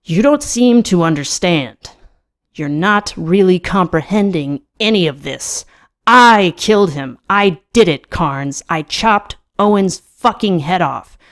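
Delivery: loud, forceful confession; outburst. A narrator's reading. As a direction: insist on every word, punching the key ones. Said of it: "trying to ignore my outburst".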